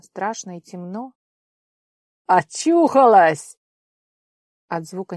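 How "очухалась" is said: gruff man voice. Acted. Gruff stranger exclaims in dark.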